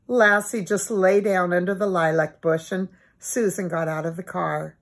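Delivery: ordinary delivery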